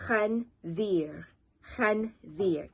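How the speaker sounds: "Khanzir" split with a gap, khan, zir; syllable by syllable. Shown as a demonstration. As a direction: sound out "Khanzir" slowly in two beats. Teacher models word slowly in parts.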